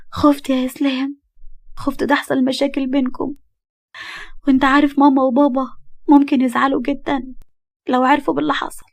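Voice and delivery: a scared wife begging him to stay out of it; frightened young wife. Acted.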